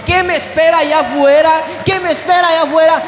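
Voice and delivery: shouted out, pushing hard on each question; preached shout. Retold or imitated. Preacher shouting the disciples' question at the congregation. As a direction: cry out the question to the whole church.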